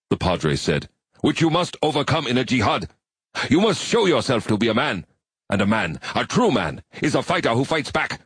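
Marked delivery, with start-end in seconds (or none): 1.2-8.2 higher, stern commanding preacher. A narrator's reading. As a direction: rouse him to fight. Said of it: "The Padre's voice was stern".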